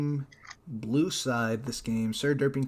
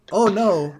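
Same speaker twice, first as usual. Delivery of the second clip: alarmed; 6 semitones higher, 9 dB louder than usual. Real, not acted. Reacting to game.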